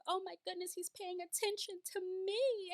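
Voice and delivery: high, breathless delight; giddy Mary. Retold or imitated. Host imitating Mary's giddy inner thoughts. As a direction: gush like a flattered fan. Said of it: "she gets so giddy".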